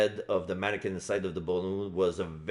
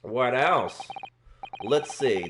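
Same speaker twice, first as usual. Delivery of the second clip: ordinary delivery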